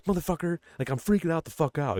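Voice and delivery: high, agitated imitation of panic; mock freak-out. Cop freaking out, retold.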